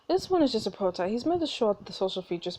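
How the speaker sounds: ordinary talk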